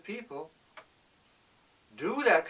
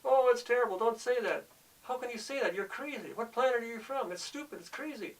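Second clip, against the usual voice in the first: higher, mock-horrified outcry; horrified people; 7 semitones higher than usual. Horrified listeners scolding the speaker, higher mock outrage. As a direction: play the shocked crowd scolding him, pitched up. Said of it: "people are horrified".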